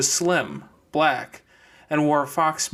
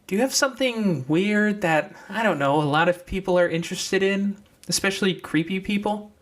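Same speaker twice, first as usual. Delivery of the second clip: put-on high young girl's voice; deer girl's voice; 6 semitones higher than usual. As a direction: pitch up into the deer girl. Deer questions Clementine.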